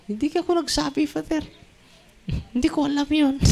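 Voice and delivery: high, puzzled voice of the woman; innocent woman. Retold or imitated. Priest voices the delivered woman. (boses ng nagtatakang babae)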